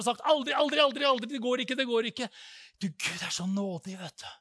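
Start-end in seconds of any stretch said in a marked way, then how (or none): 0.3-2.3 loud, high frightened never-never; panicked loud pleading; panicked refusal. Retold or imitated. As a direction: refuse in terror, loud and higher. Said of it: "løpt for mitt liv i frykt, angst og redsel".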